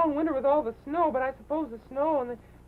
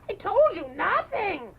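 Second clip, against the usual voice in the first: 1 emphatic snap; 5 semitones higher, 5 dB louder, slower than usual. Acted. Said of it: "You don't have to yell".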